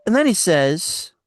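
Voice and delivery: usual speaking voice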